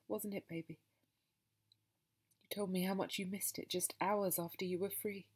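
ordinary delivery, nothing marked

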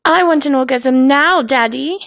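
Veruca Salt. Acted. Joking demand to Daddy, annoying Veruca-style voice. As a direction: whine it as a demanding British brat. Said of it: "In my Veruca Salt voice".

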